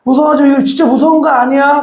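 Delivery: high, quick, gentle reassurance; reassuring mother. Retold or imitated. Mother reassuring a scared child quickly. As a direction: hurry to calm the scared child. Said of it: "안심을 시켜주잖아요".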